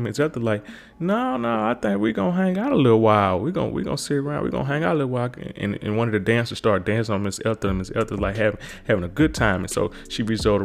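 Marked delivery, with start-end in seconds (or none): 1.0-5.3 high falsetto granny, fading back down; grandma Ethel insisting they stay, high sassy falsetto; mock sassy grandma.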